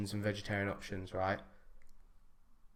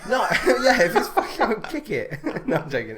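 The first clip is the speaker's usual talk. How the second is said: high, loud giggling talk; words bursting with real laughter; laughing through words; 10 semitones higher, 8 dB louder than usual. Real, not acted. Laughing at his mate's chicken attack joke, loud and high.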